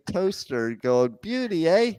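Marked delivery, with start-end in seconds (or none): 1.2-1.9 imitating a Canadian, higher; a quick "Beauty, eh?" in a Canadian accent. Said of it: "imagine this Canadian ... going".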